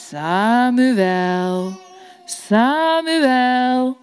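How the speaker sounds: voice of God calling. Acted. God calls a third time.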